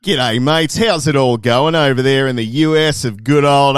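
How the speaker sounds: put-on loud broad Australian accent; hammed-up broad Australian accent. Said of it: "talk broad Australian accents".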